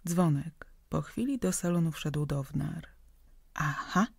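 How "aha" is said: a touch of triumph; triumphant whisper. A narrator's reading. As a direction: score a small point with it. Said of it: "szepnęła Tunia triumfująco".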